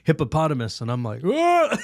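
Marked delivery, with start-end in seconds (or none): 1.2-1.8 loud, very high mock scream; comic shriek of fright; startled yelp. Storyteller faces the hippo's giant head, yelping in mock terror.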